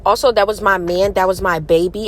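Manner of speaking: put-on loud, overdone New York accent. Wife playfully warning viewers off her husband, New York accent. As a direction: go too deep with a mock-threatening New York accent. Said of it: "I'm going a little too deep with the New York".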